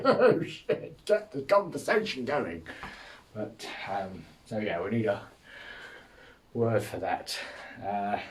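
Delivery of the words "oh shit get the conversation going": laughing panic. Retold or imitated. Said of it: "It's just like".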